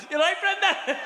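high, laughing breezy greeting; mock casual. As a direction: pretend nothing happened, cracking up. Casual greeting after the incident.

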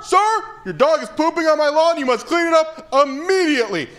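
high, shrill-pitched uptight yell; screaming neighbor. Retold or imitated. Rich neighbor screaming at comic about dog poop, high mock yell. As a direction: squeal at him like a fussy rich man. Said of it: "he's screaming".

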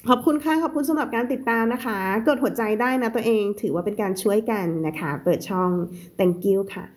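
ordinary delivery

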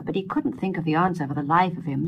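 plain reading voice

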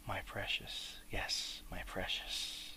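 a hushed, greedy whisper to himself; possessive whisper. Acted. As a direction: whisper it as if clutching the ring. Bilbo possessive over the ring.

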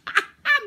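very high, loud squeal of laughter; real laugh. Real, not acted. Cracking herself up over her mirror joke, a squealing laugh. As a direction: burst out laughing at the punchline.

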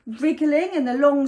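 ordinary delivery, nothing marked

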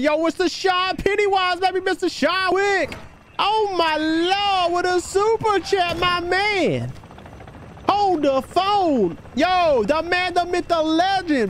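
high, slow, drawn-out hype-man call; hype shoutout. Real, not acted. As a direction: stretch it out like a hype announcer. Hyped super chat shoutout.

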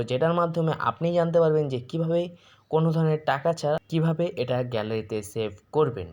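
ordinary talk

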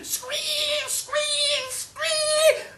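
very high, loud pig squeal; imitated pig squealing at full pitch. A narrator's reading. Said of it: "the pig was squealing".

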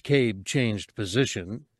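plain reading voice

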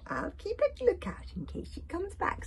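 high-pitched dragon voice; Stormfly. A narrator's reading. Dragon promising to stand guard, high coaxing. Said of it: "says Stormfly".

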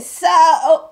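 a short loud dismissive so; mock dismissive shrug of a word; mocking her curt reply. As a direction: throw back her careless answer. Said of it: "and she said".

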